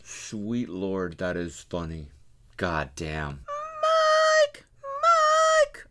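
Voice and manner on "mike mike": very high, crying yell; mock wailing Mike. Commentator mimics the woman's wail, very high. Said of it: "I wanna make a beat out of that".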